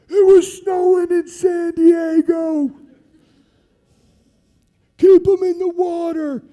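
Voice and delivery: put-on loud, high shout from the old SEALs; heckling shout. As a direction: yell down at the trainees, slow and hard.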